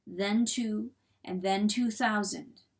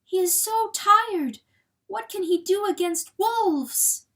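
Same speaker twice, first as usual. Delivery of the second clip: high, anguished hen's plea; pleading; 11 semitones higher, 5 dB louder than usual. A narrator's reading. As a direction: plead helplessly, voice pitched up. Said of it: "she pleaded".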